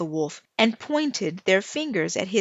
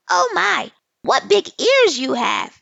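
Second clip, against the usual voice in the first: put-on much higher, loud teasing little girl; mocking tease; 8 semitones higher, 7 dB louder than usual. Asali teasing the wet wolf about his ears, high and loud. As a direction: squeal it to mock the wolf.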